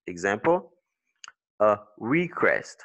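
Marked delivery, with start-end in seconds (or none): 1.6-2.8 "recrest" stressed on RE, said slowly; first-syllable stress. Shown as a demonstration. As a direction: hit RE in "recrest", let CREST fall. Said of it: "stress is on the first syllable".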